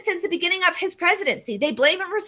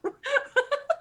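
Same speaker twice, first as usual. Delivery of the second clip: short, high, disbelieving laugh; exasperated laugh; 9 semitones higher, 8 dB quieter than usual. Real, not acted. Laughs after "nuts.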